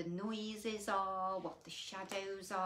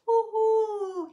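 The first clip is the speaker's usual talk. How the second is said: high, loud owl hoot; 11 semitones higher, 16 dB louder than usual. Acted. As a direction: call out like an owl. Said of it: "he'll say to you as he goes flying by".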